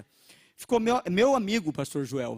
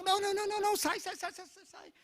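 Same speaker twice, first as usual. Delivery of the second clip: high, rapid, panicked "não, não, não"; frightened Italian lady; 13 semitones higher, 6 dB quieter, faster than usual. Retold or imitated. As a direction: shoo them off in alarm. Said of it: "Então". (senhora italiana assustada, voz aguda e apressada)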